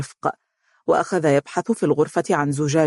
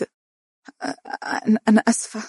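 stumbling, breathy false starts, then a higher apology; flustered stammer; 6 semitones higher, slower than usual. A narrator's reading. Bride apologizing to her husband, stammering in embarrassment. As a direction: trip over the first word, then blurt sorry. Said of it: "بخجل واضطراب".

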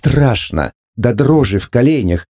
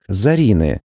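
ordinary delivery, nothing marked

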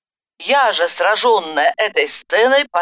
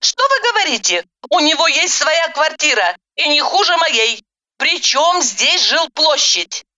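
high, indignant woman's retort; angered heroine; 8 semitones higher than usual. A narrator's reading. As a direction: object crossly in a higher voice. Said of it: "Я рассердилась". (сердито, возмущённо)

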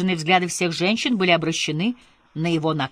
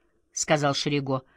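ordinary delivery, nothing marked

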